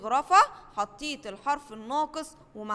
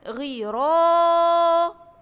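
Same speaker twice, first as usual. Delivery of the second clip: drawn-out, higher, louder word; stretched out slowly; 5 semitones higher, 9 dB louder, much slower than usual. Shown as a demonstration. Teacher models the word for first graders, drawn out slowly. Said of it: "كلمة".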